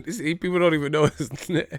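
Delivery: laughing through the words. Real, not acted. Vlogger laughing at old media's blunder. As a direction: chuckle it out, amused.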